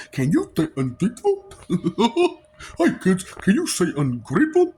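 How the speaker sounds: lightly voiced, sing-song children's TV host; mock kids' show host.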